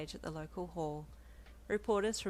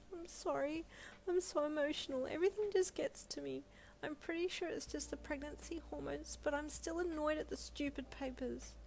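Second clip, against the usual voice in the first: sniffly apology; 10 semitones higher than usual. A narrator's reading. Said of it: "You sniffed".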